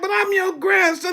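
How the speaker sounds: pleading Moses. Acted.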